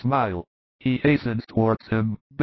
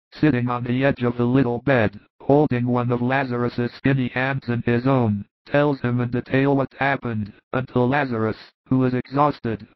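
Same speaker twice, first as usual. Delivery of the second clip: usual speaking voice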